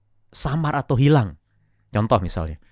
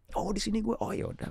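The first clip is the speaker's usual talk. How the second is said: quiet whisper to oneself; whispered inner thought; 11 semitones higher, 10 dB quieter than usual. Retold or imitated. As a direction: whisper it like thinking aloud. Imagined listener's inner thought.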